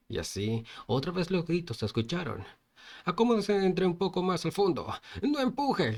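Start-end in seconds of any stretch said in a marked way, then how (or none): 3.0-5.9 acted shouting. Passengers shouting at each other in a packed bus, high-pitched yell. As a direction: call out higher, climbing at the end.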